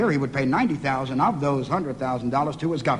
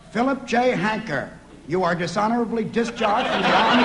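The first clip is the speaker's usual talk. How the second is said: higher-pitched formal sentencing; mock military pronouncement; 6 semitones higher than usual. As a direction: pronounce it like an officer discharging a soldier. Acts a military discharge pronouncement.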